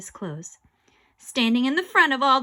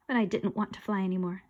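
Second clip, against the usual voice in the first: ordinary delivery, nothing marked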